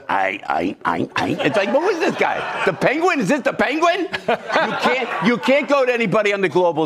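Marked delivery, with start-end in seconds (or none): 0.1-1.3 Chuck Schumer impression. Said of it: "Every time Chuck opens it".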